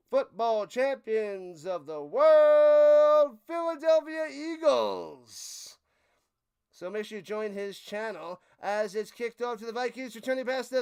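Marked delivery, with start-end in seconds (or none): 0.1-5.8 slow, stretched, much louder big finish; drawn-out announcer shout. Pretend. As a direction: boom it out like an arena announcer. Hype promo climax.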